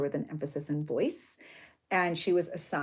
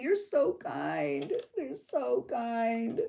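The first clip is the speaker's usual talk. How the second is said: very slow, drawn-out, teary thanks; touched; 4 semitones higher, 4 dB quieter, much slower than usual. Real, not acted. Moved mom thanking a viewer, touched and tender. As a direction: stretch each word, overcome with gratitude.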